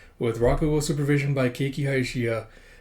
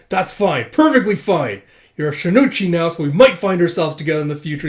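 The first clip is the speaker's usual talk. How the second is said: loud, higher, forced politeness; mock seething; 3 semitones higher, 7 dB louder than usual. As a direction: say it through gritted teeth. Rival gritting his teeth.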